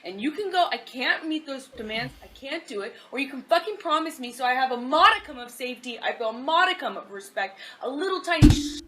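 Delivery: mock shouting rant. Acted girlfriend rant, loud.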